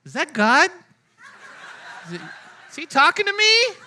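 high, laughing amazement; giddy high laugh at a sign speaking to you; laughing disbelief. Retold or imitated. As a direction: laugh it out like you can't believe it. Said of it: "I'm like".

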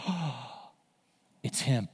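soft, low, awed recognition; hushed realization. Acted. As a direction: say it under the breath in wonder. Acted awed recognition after a gasp.

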